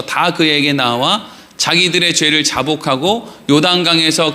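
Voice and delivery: low, measured Bible reading; solemn congregational reading pace; scripture reading. Acted. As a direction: read the verses out evenly, in a low voice. Reads scripture aloud with congregation.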